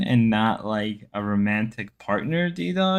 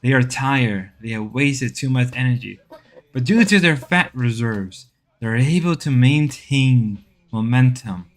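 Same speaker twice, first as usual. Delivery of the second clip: nature documentary. Pretend. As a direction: narrate seals like a documentary. Documentary narration continues.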